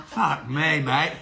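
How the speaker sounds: talking through a mouthful; eating crunchy fried chicken. Real, not acted. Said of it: "Oh, sorry, I'm eating chicken".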